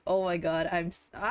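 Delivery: ordinary delivery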